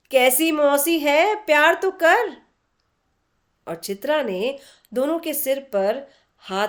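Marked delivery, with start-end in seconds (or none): 0.1-2.4 louder, mock-scolding older friend; teasing scold. A narrator's reading. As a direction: nudge her friend sharply, half-joking. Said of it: "तभी अरुणा ने टोका". (सहेली को हल्की, मज़ाकिया डाँट)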